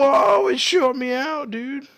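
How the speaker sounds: high, loud whoa of real surprise; surprised shout. Real, not acted. Player shot out of a cannon, real surprised yell. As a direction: yell as the cannon launches you.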